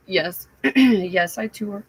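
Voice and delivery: ordinary talk, nothing marked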